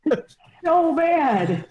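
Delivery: high, drawn-out words through real laughter; laughing. Real, not acted. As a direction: squeal it out while cracking up. Cracking up at the imitation.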